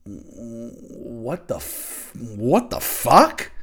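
very slow, stretched what the fuck; drawn-out disbelief. Acted. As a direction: let the words hang in shock. Drawn-out disbelief over music.

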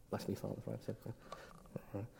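rattled off very softly under the breath; barely audible, rushed confession opening; mumbled confession. Retold or imitated. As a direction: mumble the formula as a routine.

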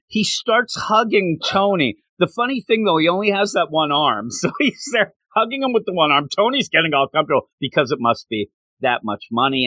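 ordinary delivery

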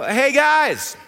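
loud, high call to the basement; yelling downstairs. Retold or imitated. Dad yelling down to the boys in the basement, loud call. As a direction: call down the stairs to the kids. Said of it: "So I yell downstairs".

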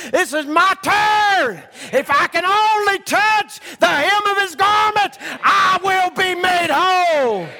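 high, loud, slow preaching cry; desperate vow. Retold or imitated. Preacher voicing the bleeding woman pushing through, shouting. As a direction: cry out the vow with everything you have.

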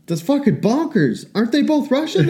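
high incredulous question; retold disbelief. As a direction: ask it like it's unbelievable. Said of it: "And I was like".

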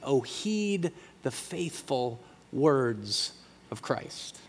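near-whispered, slow and soft; hushed quote. Acted. Pastor softly quoting the call to heed Christ's words, near-whispered.

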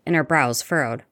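ordinary delivery, nothing marked

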